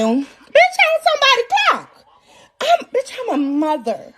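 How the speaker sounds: very high, squealed comeback; indignant retort. Acted. Mother answers "where were you", high indignant retort. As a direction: squeal it back in hammed-up indignation.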